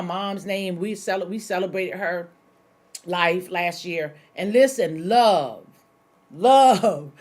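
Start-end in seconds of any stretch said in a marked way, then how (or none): 4.5-7.1 loud, ringing "love" called out twice; emphatic call. Real, not acted. Preacher to congregation, proclaiming "love" loudly. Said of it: "shouting her mom's motto".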